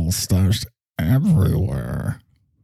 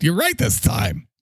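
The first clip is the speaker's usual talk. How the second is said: ordinary delivery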